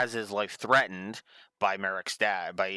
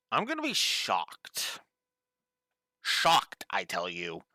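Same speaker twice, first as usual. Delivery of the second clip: mock shock. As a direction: act theatrically shocked.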